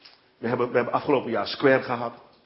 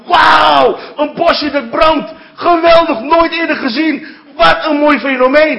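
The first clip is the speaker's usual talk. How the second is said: high, booming mock amazement; amazed shouting; 14 dB louder, slower than usual. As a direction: shout it out like an astonished Moses. Voices Moses amazed at burning bush. (verbaasd uitroepen)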